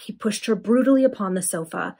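plain reading voice

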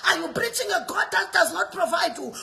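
ordinary talk, nothing marked

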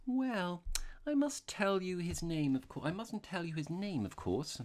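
high-voiced, artless little priest; simply said. A narrator's reading. Priest protecting a penitent's name, simply. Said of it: "said the little man simply".

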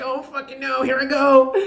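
mock panic. Acted mock dread, high.

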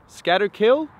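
high, loud rising question on Scatterkill. Real, not acted. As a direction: try the name out loudly, pitch climbing. Louder second try at name.

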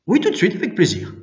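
quick, high, happy yes; keen, hurried boyish reply; eager acceptance. A narrator's reading. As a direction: agree at once, voice lifted. Boy accepts gladly.

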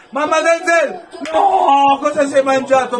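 loud, high cry of dismay; exasperated shout. Real, not acted. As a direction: yell at the missed chance. Missed chance, fan exasperated. (grido esasperato)

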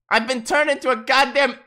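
high, loud, furious yelling; outraged shout. Real, not acted. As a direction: scream at the screen in outrage. Streamer outraged at his avatar.

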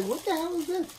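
high surprised exclaim; shocked. Retold or imitated. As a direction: pitch up in disbelief. Retold shocked reaction on seeing.

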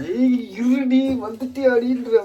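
high, loud playful growl of threat; menacing dog voice. Retold or imitated. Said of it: "ஒரு மாதிரி கொடூரமான பார்வையா".

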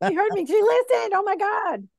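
high, laughing mock amazement; playful excited celebration, pitched high; celebrating guides. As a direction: gush in delight at being heard. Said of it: "they're all high-fiving themselves".

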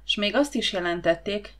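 ordinary delivery

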